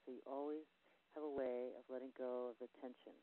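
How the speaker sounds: guided visualization. Acted. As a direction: lead them gently through a spa-like relaxation.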